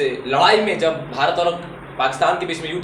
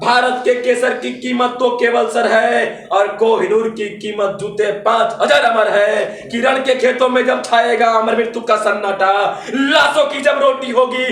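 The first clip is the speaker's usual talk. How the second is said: high, louder declamation of patriotic verse; fiery poetry recitation; 9 semitones higher, 7 dB louder than usual. Acted. Poet rousing the audience to wake India, fiery declamation. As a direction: declaim it as a rousing call to arms.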